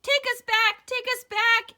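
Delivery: high, loud frantic cries; quacking plea. Acted. Eddie begging to go home, frantic loud cries. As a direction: beg at full volume. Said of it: "Eddie quacked".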